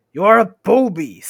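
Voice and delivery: high, loud, drawn-out name-calling; troll insult. Acted. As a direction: hurl the silly insult loudly.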